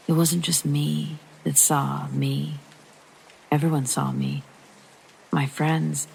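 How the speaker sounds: ordinary delivery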